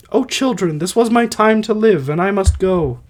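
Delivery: high, theatrical dying-wife voice; Alcestis voice. Acted. Dying mother lamenting to her children, high put-on voice. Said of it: "I'll try to do voices".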